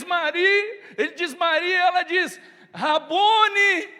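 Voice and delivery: high, loud joyful cry with laughter; joyful recognition. Acted. Mary recognizing her teacher, joyful loud cry. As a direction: burst out in joy at the name.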